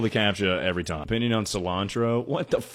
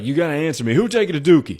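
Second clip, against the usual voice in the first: high-pitched, loud mock interrogation; imitated insistent shout; 7 semitones higher, 8 dB louder, faster than usual. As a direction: demand an answer through the door. Man demanding answer through door.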